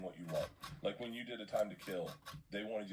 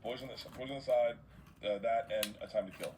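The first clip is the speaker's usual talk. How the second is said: ordinary talk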